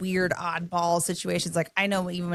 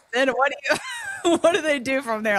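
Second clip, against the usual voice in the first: laughing; 9 semitones higher, 5 dB louder than usual. Real, not acted. Laughing after robot bit.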